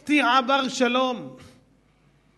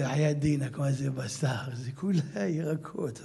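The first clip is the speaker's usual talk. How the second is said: mock vendor; 8 semitones lower, 5 dB quieter, slower than usual. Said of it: "הוא אומר לי".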